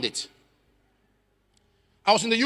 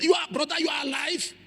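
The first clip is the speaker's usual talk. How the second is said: higher, incredulous cry; stunned disbelief; 6 semitones higher than usual. Retold or imitated. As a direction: ask as if seeing a ghost. Sister's stunned disbelief on phone.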